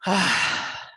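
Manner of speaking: audible exhaled sigh; releasing sigh. Shown as a demonstration. Yoga teacher showing how to release tension, a sigh. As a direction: let the tension out in one falling sigh. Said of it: "just sigh or yawn".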